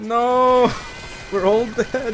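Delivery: sudden high, loud cry of dismay; shouting no. Real, not acted. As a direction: yell it as everyone dies. Whole team dying in game.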